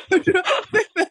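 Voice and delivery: giggling through the words; laughing. Real, not acted. Teasing her co-host about crying over crisps, laughing. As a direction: laugh while spilling the secret. Laughing while exposing co-host's secret.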